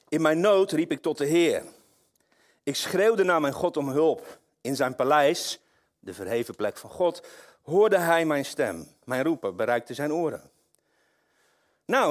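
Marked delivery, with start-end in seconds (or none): 0.1-10.5 loud, rising psalm reading from the pulpit; preacher crying out the psalm to the congregation; fiery scripture reading. Acted. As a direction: proclaim the psalm with full force. Reads Psalm 18 with force.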